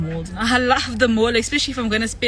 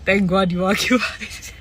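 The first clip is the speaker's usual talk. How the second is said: normal talking voice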